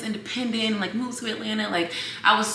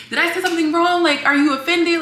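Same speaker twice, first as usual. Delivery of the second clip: higher, concerned quoted cashier; worried stranger; 11 semitones higher, 4 dB louder, faster than usual. Retold or imitated. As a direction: voice the cashier's worried question, pitched up.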